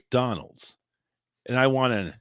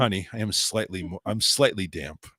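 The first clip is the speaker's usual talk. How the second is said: mock bedroom talk; 5 semitones lower, 4 dB quieter than usual. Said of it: "I'm over here thinking we're in bed".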